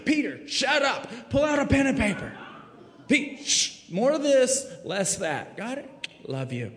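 high, bright teasing teacher voice; a fond teacher hushing Peter, hammed up; playful Jesus. Jesus teaching disciples to pray, playfully bossy. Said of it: "Jesus said it like this".

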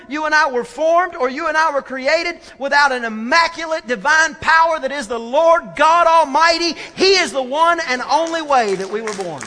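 loud, high-pitched declaration of faith; driving, emphatic pulpit delivery; impassioned preaching. Real, not acted. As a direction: preach it at full force, phrase after phrase. Preaches at full force.

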